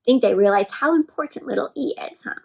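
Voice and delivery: normal talking voice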